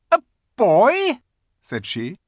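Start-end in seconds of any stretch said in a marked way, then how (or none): 0.1-1.2 high, loud, incredulous echo; astonished queen. A narrator's reading. The Queen learns he is a boy, astonished. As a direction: throw it back loudly in astonishment.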